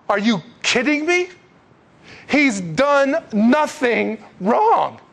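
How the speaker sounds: high, incredulous protest, drawn out slowly; stunned Pilate. Retold or imitated. Pilate objects to the crowd's verdict, stunned and high-pitched. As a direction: protest in stunned disbelief, stretching the words. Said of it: "Pilate is stunned".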